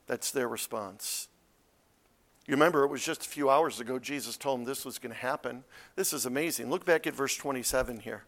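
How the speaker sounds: normal talking voice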